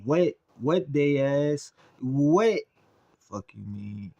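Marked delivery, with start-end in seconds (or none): 0.0-2.6 hyped. Real, not acted. Hyped reaction to highlight.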